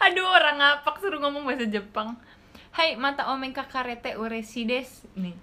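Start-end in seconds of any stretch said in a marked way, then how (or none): 0.1-2.2 higher and louder, laughing at herself; amused exclaim. Real, not acted. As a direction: cry out in amused embarrassment. Laughing at own accent.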